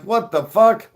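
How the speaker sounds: shocked cops. Retold or imitated. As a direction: bigger disbelieving outburst. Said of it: "And they're like".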